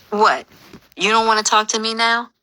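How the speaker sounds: needling, confrontational question; belligerent bark. A narrator's reading. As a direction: bark it like you're picking a fight. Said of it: "I bark".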